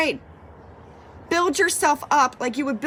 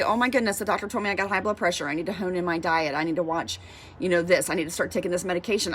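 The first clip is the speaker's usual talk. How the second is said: lower, softer, quick run-through; matter-of-fact self-talk; 7 semitones lower, 4 dB quieter, faster than usual. Acted. Patient to self after doctor's blood pressure news, matter-of-fact. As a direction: rattle it off low, lightly acted.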